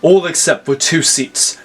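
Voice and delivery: usual speaking voice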